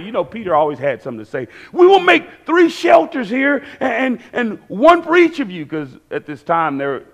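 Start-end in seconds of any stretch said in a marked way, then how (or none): 1.7-5.7 high, eager babbling imitation; acted eagerness, pitched right up; excited. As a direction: blurt it like an overexcited disciple. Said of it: "Like, he's all excited".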